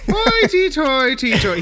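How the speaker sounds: high, slow stage-Irish "Hoity-toity-toy"; mock Irish accent. Podcaster imitates the hologram's silly catchphrase in a brogue. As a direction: ham up the brogue. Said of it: "He got this accent".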